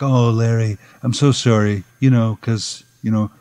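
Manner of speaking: normal talking voice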